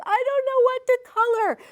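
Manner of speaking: put-on high, loud kid voice; child's complaint. Child telling parent about coloring, high loud complaint. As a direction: whine it like a stuck child. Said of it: "I love when your child goes".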